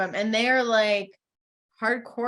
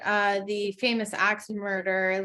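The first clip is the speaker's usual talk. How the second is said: ordinary talk, nothing marked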